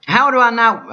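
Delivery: high, loud cut-off protest; angry outburst. Retold or imitated. Vlogger venting what he felt, angry. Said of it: "I didn't say that".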